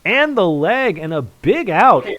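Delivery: very loud, high-pitched commentator's roar; real big-moment darts call at full voice; excited winning call. Real, not acted. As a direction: shout the checkout home. Commentator calls the winning checkout.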